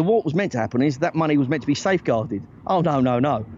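usual speaking voice

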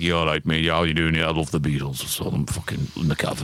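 put-on deep low old Scouse accent. Shown as a demonstration. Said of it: "the deep voice like that".

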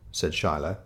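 plain reading voice

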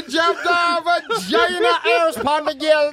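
put-on slow, high Jamaican accent; loud hammed-up Jamaican accent. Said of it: "Do it in a Jamaican accent".

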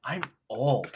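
play-acted feeble complaint; mock whining star. Host voicing a tired actor, in a mock whine.

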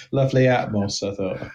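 ordinary delivery

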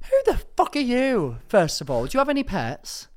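high, loud, outraged challenge; furious comic tirade; indignant outburst. Acted. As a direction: round on someone who insulted your pet. Indignant row over dead tortoise.

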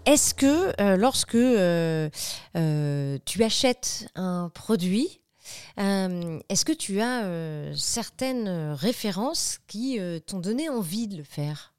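slow smooth seductive voice; suave voice. Acted. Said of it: "je prends ma voix suave".

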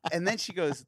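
usual speaking voice

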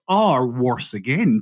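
normal talking voice

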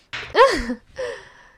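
high, loud teasing giggle; bright flirty laugh; high playful laugh. Acted. Playful laugh mid-scene.

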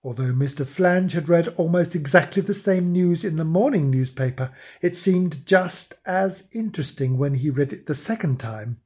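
ordinary delivery, nothing marked

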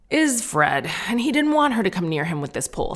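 ordinary talk

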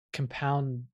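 soft, low, halting "compounded"; unsure of pronunciation. Acted. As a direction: test the word "compounded" under the breath. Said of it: "Am I saying that right?".

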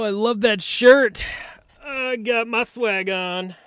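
high, slow showing-off; playful greeting. Real, not acted. Guest showing off his outfit to the hosts, high slow swagger. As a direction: strut it out a little.

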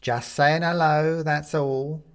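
high, slow creaky-sweet skeleton. A narrator's reading. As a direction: repeat it with false friendliness. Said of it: "repeats the skeleton".

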